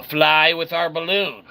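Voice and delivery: ordinary talk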